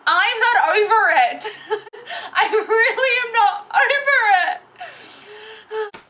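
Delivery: high wailing through laughter; half laugh, half wail, drawing out the words; laughing distress. Real, not acted. Vlogger confesses she is still embarrassed, wailing with laughter.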